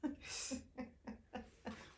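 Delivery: short breathy burst of laughter. Real, not acted. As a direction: laugh in quick little pulses. Laughs after calling regret kind.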